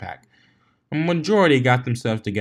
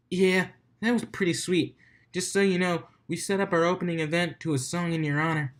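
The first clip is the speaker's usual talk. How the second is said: higher friendly emcee; DJ chatting; 7 semitones higher than usual. Acted. DJ telling the guests about their song, upbeat. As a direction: toss it out to the heroes.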